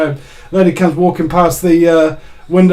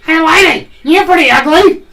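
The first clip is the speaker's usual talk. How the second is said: high, louder squawking parrot; cockatoo voice; 11 semitones higher, 4 dB louder than usual. Retold or imitated. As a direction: do the cheeky parrot from the pet shop. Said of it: "the cockatoo goes to her".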